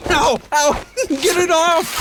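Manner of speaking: high, yelping cries of pain; howling in pain. A narrator's reading. Shrek scratched by the cat, howling high. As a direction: yell with claws in your face. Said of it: "Shrek howled in pain".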